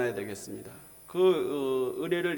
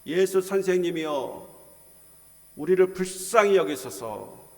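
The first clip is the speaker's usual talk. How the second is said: imitated crying out; 4 semitones higher, 5 dB louder than usual. Lepers calling to Jesus for mercy, imitated cry. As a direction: call out as if begging for mercy. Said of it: "예수님을 향하여 소리를 지릅니다".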